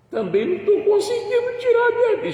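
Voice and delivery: voz aguda e chorosa, imitando a mulher; chorando imitado. Retold or imitated. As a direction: fala chorando, bem mais aguda. (imitando choro, voz aguda e trêmula)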